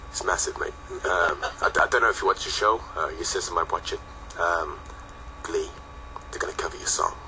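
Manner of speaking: deadpan, low and soft, utterly unexcited; unconvincing. Retold or imitated. Manager ringing the band with big news, flat and underwhelming. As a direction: mumble huge news like it's nothing. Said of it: "in the most unconvincing way possible".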